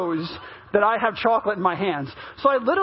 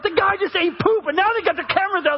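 raised-pitch imitation of a stunned crowd; shocked onlookers; 7 semitones higher than usual. Onlookers filming the pastor eating moose poop, mock shock. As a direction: retell the bystanders' shock, pitched up and hurried.

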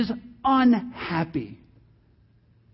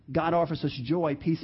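normal talking voice

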